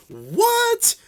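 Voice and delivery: very high, strangled disbelief; shocked squeak. Retold or imitated. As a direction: squeal it like your brain just broke. Incredulous at overheard trade.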